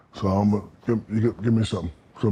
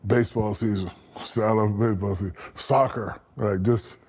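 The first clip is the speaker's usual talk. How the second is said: usual speaking voice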